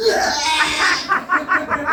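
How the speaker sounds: high, drawn-out yell; mock scream. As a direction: hold one long, high silly shout. Another acted winner yelling.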